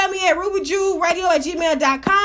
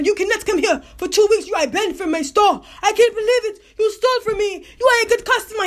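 raised, pleading voice of the shocked store man; wounded store owner, pitched up; 6 semitones higher than usual. Retold or imitated. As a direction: play the hurt shopkeeper who can't believe it. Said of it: "The man said".

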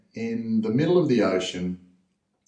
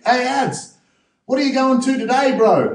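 much higher, loud, friendly coach voice; mock coach call; 5 dB louder than usual. Dive coach asking the daily target: high, breezy call. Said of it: "they'd say".

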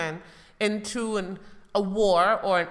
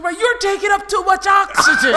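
high, loud mock passenger yell; angry shout; 11 semitones higher, 7 dB louder than usual. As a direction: snap at a fellow passenger. Acted plane passenger quarrel.

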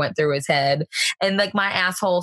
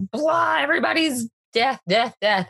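mock monster rant; 7 semitones higher than usual. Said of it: "he's just like".

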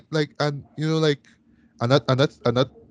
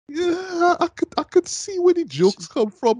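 high, grudging concession. Real, not acted. Conceding the Gaga accent jokes.

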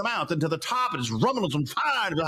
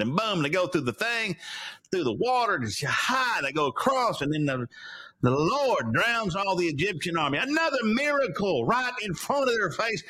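ordinary talk, nothing marked

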